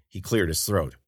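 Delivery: usual speaking voice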